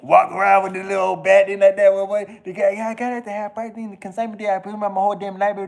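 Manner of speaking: ordinary talk, nothing marked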